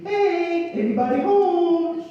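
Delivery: high, drawn-out hail; yoo-hoo call. A narrator's reading. Sponge Bobby calling into the coral, high yoo-hoo. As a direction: call out high to whoever's inside. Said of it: "yoo-hooed".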